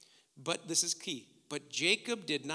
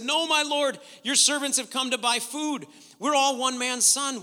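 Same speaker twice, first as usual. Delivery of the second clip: louder, higher pleading of innocence; earnest protest; 7 semitones higher, 8 dB louder than usual. Acted. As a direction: plead your case to a lord. Brothers protest to Joseph's accusation.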